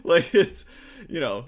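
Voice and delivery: normal talking voice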